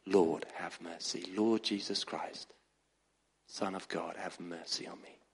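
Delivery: very low, hushed, slow repeated plea; retold desperate prayer. Preacher retelling a blindfolded priest's prayer, hushed slow plea. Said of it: "worried and scared, he said, "I just prayed".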